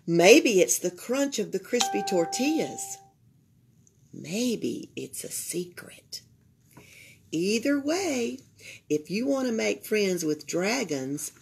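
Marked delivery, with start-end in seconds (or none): 4.1-6.2 hushed, slow secret whisper. A narrator's reading. Reader whispering a secret to the children. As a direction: breathe it like a shared secret.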